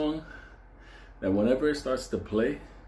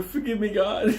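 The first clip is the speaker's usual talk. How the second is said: laughing; 9 semitones higher than usual. Real, not acted. Laughing mid-joke.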